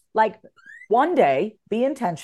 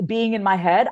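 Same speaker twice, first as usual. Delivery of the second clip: ordinary talk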